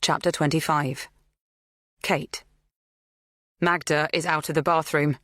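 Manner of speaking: ordinary delivery, nothing marked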